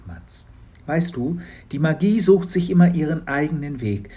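a hint of a kind old lady; Frau Kramer, lightly voiced. Frau Kramer reassures Matz. (leicht gesprochene, gütige Frau Kramer)

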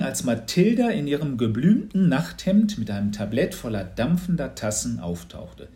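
plain reading voice

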